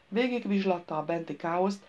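usual speaking voice

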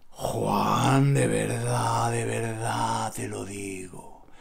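low, soft, earnest; sincere. Real, not acted. Assuring Juan he's telling the truth, low soft sincerity. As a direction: tell him quietly that you mean it.

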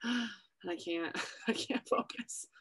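breathless, whispered panic; half-whispered, overwhelmed and anxious; panicked whisper. Retold or imitated. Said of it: "you're like".

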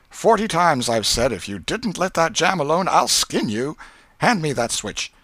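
high-pitched scolding aunt. A narrator's reading. Aunt threatening the jam thief, scolding.

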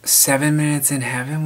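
ordinary talk, nothing marked

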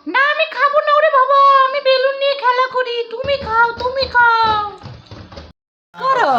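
put-on toddler voice. Mother voicing her toddler asking for the balloon, high childlike plea. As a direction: plead like a little kid asking mama.

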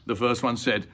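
plain reading voice

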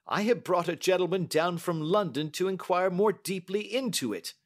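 high, emphatic banker's voice; adamant banker. Acted. Uncle refusing his niece's plea to hush it up, adamant. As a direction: refuse firmly, high and agitated.

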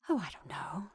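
a soft whisper that shrinks from the subject; barely voiced, pulling back from the question; shrinking away. A narrator's reading. Frightened neighbour shrinking from a murder question, whispered. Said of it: "in a voice that seemed to shrink away".

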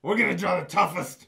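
big hyped-up shout; rally the crowd, full voice; hype shout. Acted. Hyping kids before drawing.

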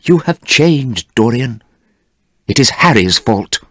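regretful, understated reproach; sad. A narrator's reading. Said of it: "said Basil sadly".

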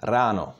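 "Rano" with a fully pronounced, rolled R; rolled Czech R. Shown as a demonstration. Czech teacher reads the exercise word, "Rano" with rolled R. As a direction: say "Rano" with R like a Scottish R. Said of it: "R in Czech is fully pronounced".

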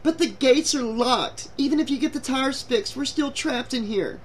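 high, tearful-sounding protest; sniffling. A narrator's reading. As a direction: plead high, as if close to tears. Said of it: "Julie sniffled".

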